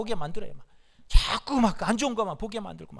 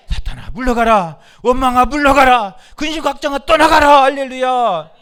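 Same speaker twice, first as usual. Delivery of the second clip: loud rebukes climbing higher; commanding shouts, building; 4 semitones higher, 12 dB louder than usual. Real, not acted. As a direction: build to a rousing, drawn-out battle cry. Climactic rebukes of Satan and worry. (점점 높아지는 우렁찬 축사 외침)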